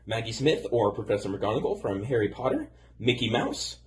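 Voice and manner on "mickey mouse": ordinary talk, nothing marked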